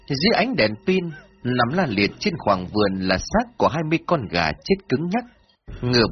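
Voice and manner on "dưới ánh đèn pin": ordinary delivery, nothing marked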